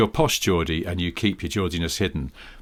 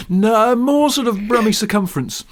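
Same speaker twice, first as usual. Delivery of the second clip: higher, louder put-on voice parroting the phrase; mock accent voice; 11 semitones higher, 4 dB louder than usual. Teasing a co-host by echoing his phrase in a mock voice. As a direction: lightly ham up the accent.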